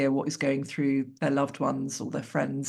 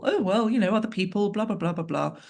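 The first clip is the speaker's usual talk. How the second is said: higher-pitched, airy brush-off; mock dismissive; 5 semitones higher than usual. Speaker mimics someone refusing acceptance, mock dismissive. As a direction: wave it away in a lighter, higher voice, tailing down through the blahs.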